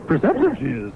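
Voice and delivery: high, croaky little Jedi master; Yoda voice. Pretend. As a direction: talk like wise old Yoda. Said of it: "Dueling Yoda impersonation".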